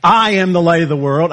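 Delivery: "I" punched and held at the start; "I" stands out from the rest; stress on I. Shown as a demonstration. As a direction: hit the word "I", then run on. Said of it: "he could have emphasized anything".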